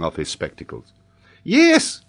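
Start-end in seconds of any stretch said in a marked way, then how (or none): 1.4-2.0 put-on high, loud child's yes; high-pitched schoolboy. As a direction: chirp it like a sure little pupil. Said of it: "continued the child".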